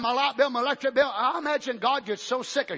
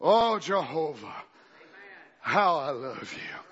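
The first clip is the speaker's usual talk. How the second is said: much lower, softer, slow adoring prayer; tender worship; 9 semitones lower, 3 dB quieter, slower than usual. Shown as a demonstration. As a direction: whisper your love to God, slowly. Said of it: "first you say a little worship".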